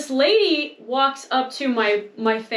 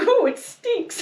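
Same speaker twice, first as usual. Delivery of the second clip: disgusted; 9 semitones higher, slower than usual. Retold or imitated. Scout grimaces at her.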